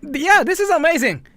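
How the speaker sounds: high, loud, gushing fake praise; fake delight. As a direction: overdo the polite enthusiasm over bad food. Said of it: "and you just go like".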